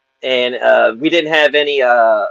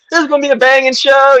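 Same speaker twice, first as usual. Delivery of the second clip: much higher, louder hype call; hyped announcer promo; 12 semitones higher, 4 dB louder than usual. Retold or imitated. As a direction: sell the show like an announcer. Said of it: "I remember I said".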